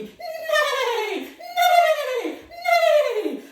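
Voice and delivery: loud high neigh; whinny like a galloping horse. Shown as a demonstration. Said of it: "join in with me".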